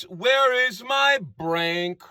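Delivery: very slow, drawn-out question; singer's slow opening line, quoted. Retold or imitated. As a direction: stretch out Where is my Brank. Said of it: "he says".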